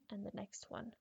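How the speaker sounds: plain reading voice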